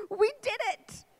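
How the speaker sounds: high-pitched squeal of triumph; exuberant. Retold or imitated. Bride to groom at the altar, exuberant. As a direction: burst out high in gleeful victory. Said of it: "really exuberant".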